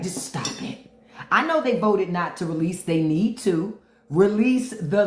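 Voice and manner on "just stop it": whispered hush imitating Trump. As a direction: hiss a quick "stop it" under the breath. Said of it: "he would be like".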